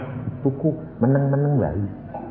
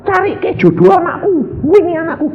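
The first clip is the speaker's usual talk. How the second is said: higher, whiny mock prayer voice; mock demanding prayer; 4 dB louder than usual. Preacher mocks a believer bossing God in prayer, higher whiny voice. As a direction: lampoon a believer ordering God around.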